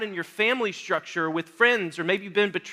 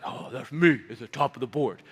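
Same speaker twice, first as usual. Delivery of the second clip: smug mockery; 9 semitones lower, 4 dB quieter than usual. Mimicking smug lifters, low voice.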